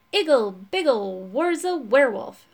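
loud, chanted incantation; magic spell. Acted. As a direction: cast it like a wizard's spell. Wizard casting a spell.

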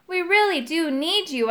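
understated shout. A narrator's reading. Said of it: "I shouted".

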